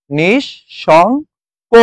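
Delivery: "নিঃশঙ্ক" as nish-shong-ko, very slow with the sh said twice; doubled consonant pronunciation. Shown as a demonstration. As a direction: sound out "নিঃশঙ্ক" slowly, doubling the sh. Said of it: "দুইবার উচ্চারিত হয়". ("নিঃশঙ্ক" ধীরে, শ দুইবার উচ্চারণ করে)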